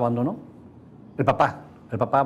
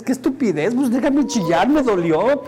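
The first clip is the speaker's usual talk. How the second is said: higher complaining inner voice; mock frustrated kid; 7 semitones higher than usual. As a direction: protest like a hurt kid. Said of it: "es algo que dices". (queja de niño adolorido, más aguda)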